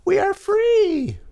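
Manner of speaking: high, stretched-out squeaky germ cry; yelled cheer. A narrator's reading. Cold germs celebrate being freed, high squeaky yell. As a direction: squeal it slowly in triumph. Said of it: "yelled the cold germs".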